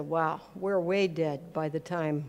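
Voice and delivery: usual speaking voice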